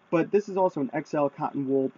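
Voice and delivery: ordinary talk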